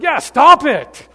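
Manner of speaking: big, slow, high-pitched yell; very loud, drawn-out comic yell; Bob Newhart yelling. Retold or imitated. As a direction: bellow it as the exasperated psychiatrist. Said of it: "him yelling at this woman".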